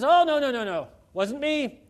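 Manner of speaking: lightly voiced Adam pleading innocence; Adam protesting.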